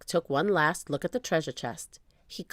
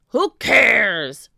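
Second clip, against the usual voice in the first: loud roar with a stretched word; pirate roar; 4 semitones higher, 10 dB louder than usual. A narrator's reading. Captain answering Annie's reproach with a roar. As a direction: roar it dismissively. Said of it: "roared Cap'n Bones".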